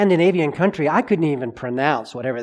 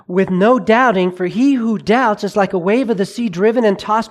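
louder, slower preaching of the verse; preaching scripture; 4 semitones higher, 5 dB louder than usual. Acted. Preacher reading James to the congregation, forceful preaching. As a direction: proclaim it to the pews.

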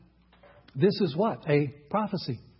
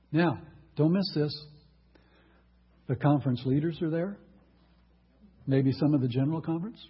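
usual speaking voice

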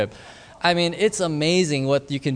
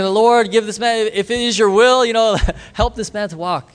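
raised, high-pitched public prayer; loud prayer; 6 semitones higher than usual. Retold or imitated. Preacher praying over the crippled man before onlookers, loud. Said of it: "He prays so loud".

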